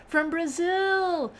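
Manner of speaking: high, stretched-out cheer; slow, high, delighted welcome; excited greeting. Real, not acted. Greeting a viewer from Brazil.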